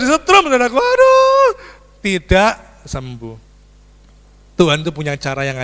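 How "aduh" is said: high, loud aduh; pained yelp. Retold or imitated. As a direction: yelp out in pain. (aduh yang tinggi dan keras)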